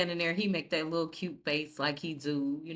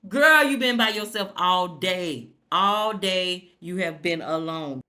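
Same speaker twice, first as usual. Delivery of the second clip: loud taunt; 8 dB louder than usual. Real, not acted. Taunting the character on screen.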